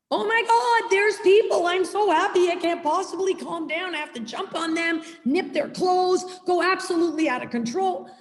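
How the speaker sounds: high, breathless, giddy puppy voice, words spilling out; overexcited dog. Retold or imitated. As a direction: play the dog who cannot contain its joy at guests.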